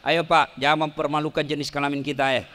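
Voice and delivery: normal talking voice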